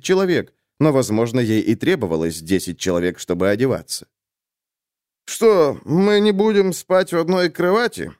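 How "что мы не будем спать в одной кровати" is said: grumbling. A narrator's reading. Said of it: "буркнул он".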